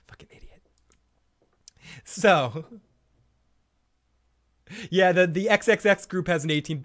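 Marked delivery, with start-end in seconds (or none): 0.1-0.6 very soft, voiceless whisper; whispered self-reproach. Real, not acted. Scolding himself over his wording, whispered self-reproach. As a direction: whisper it under your breath.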